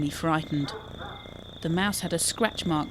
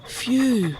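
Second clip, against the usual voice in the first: narrated sigh of relief, pitched up; relieved sigh; 5 semitones higher, 3 dB louder than usual. A narrator's reading. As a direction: let out an acted "phew". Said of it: "sighed Quinn".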